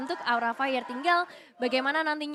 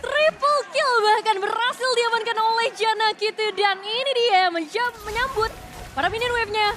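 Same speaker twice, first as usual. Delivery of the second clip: excited shouting; 6 semitones higher, 6 dB louder than usual. Real, not acted. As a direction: shout the game-winning moment. Caster calls the game win.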